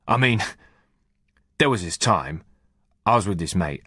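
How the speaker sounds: casual, knowing soldier talking shop; lightly voiced corporal. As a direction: tough corporal explaining himself. Heaton tells his yardie story.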